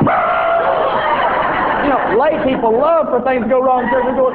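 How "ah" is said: loud low startled shout; crowd yell. Retold or imitated. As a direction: let out the whole room's scream. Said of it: "Then everybody goes".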